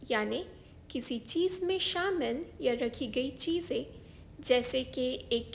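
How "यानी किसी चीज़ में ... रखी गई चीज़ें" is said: ordinary talk, nothing marked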